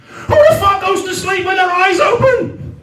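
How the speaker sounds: high, incredulous comic shout; exasperated outburst. Acted. Sleepless man arguing with the meditation app, exasperated.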